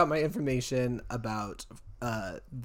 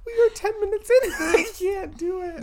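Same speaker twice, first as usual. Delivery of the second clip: high, loud wail through laughter; helpless laughter; 8 dB louder than usual. Real, not acted. Host unable to get through the story, helpless laughter. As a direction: give up in a real laughing fit.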